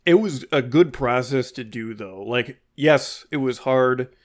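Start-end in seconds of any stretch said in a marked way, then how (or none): none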